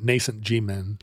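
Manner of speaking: low quiet deadpan Nascent G-Men; low mock-serious. Shown as a demonstration. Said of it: "a little hard to pronounce".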